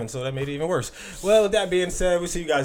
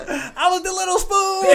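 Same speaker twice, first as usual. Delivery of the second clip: very high, drawn-out squeal through laughter; laughing mock quote; 3 dB louder, slower than usual. Delivering the little spoon punchline to co-hosts, squealing with laughter.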